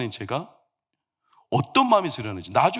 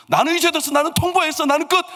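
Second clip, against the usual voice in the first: high, insistent proclamation; defiant declaration; 12 semitones higher, 5 dB louder than usual. Retold or imitated. Young suitor giving father-in-law notice, defiant. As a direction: announce it as final, pitched right up.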